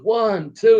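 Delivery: high, loud ref counting a pinfall; referee's count. Retold or imitated. Wrestler retelling the referee's pin count, called out high and loud. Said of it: "as soon as the referee goes".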